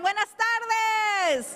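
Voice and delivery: high, slow, projected greeting; outdoor voice. Shown as a demonstration. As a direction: draw it out to the whole room. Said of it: "I'm gonna use my outdoor voice".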